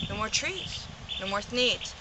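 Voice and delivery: quiet stunned regret; hushed lament. Acted. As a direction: let it fall to near silence. Quiet after the last tree.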